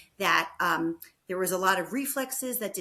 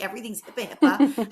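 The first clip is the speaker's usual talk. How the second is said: mock exasperation; 8 dB louder than usual. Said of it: "she's so into hipaa".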